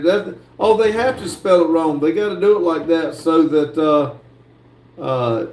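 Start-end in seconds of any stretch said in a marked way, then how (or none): none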